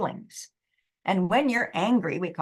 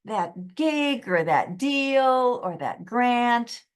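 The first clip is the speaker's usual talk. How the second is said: venting frustration; 5 semitones higher than usual. Shown as a demonstration. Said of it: "just get it off your chest and say".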